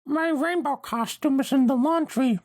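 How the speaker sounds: high, loud Pinkie voice through a mouthful; mouth full of pastry. Acted. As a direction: talk as if stuffed with muffins. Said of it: "like, her mouth kind of full of pastry".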